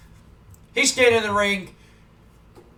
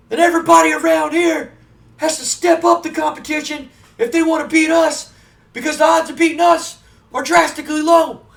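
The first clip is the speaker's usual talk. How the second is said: much higher, much louder shouting wrestler promo; Scott Steiner promo; 9 semitones higher, 6 dB louder than usual. Retold or imitated. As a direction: bellow the trash talk like a wild wrestler on the mic. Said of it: "Scott takes the microphone and goes".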